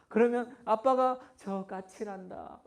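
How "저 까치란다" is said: patient father. Retold or imitated. Father answering child patiently.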